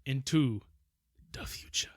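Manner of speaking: breathy dramatic whisper. Real, not acted. Friend echoing the plot twist in a dramatic whisper. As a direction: hush it like a spooky reveal.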